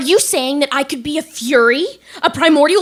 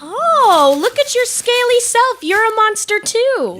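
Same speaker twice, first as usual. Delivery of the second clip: high-pitched excited discovery; delighted surprise; 10 semitones higher, 3 dB louder than usual. Acted. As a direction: gush at meeting one of your own kind. Delighted discovery of a fellow monster.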